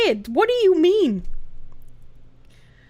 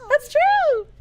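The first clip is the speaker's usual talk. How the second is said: much higher, slow put-on squeak; squeaky voicey bit; 11 semitones higher than usual. Streamer answering chat in a squeaky cartoon voice.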